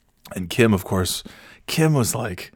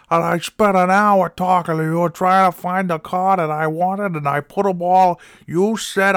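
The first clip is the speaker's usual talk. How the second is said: put-on angry old man prank caller; 7 semitones higher than usual. Prank caller as a furious old man demanding his cards. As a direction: rant like a cranky old customer on the phone. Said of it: "that was not the angry old diecast cars guy. That was me".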